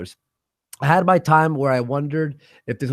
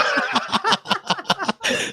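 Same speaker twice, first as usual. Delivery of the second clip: high breathless laughter; real giggling fit; laughing hard; 3 dB quieter than usual. Real, not acted. Laughing at the top scientist joke.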